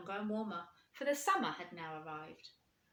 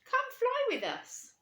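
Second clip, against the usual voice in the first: high, loud call from above; calling back; 7 dB louder, slower than usual. Acted. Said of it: "they called back".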